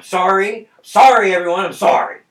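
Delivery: shouted mock apology; loud apology. Real, not acted. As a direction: yell sorry over and over.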